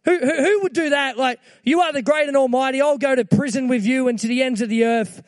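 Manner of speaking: high, loud, imitating Peter's eager protest. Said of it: "he was like".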